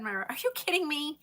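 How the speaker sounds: pitched high in retold disbelief; retelling shock to friend Myra, high and incredulous; mock disbelief. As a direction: act out your shock at the friend. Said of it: "I said".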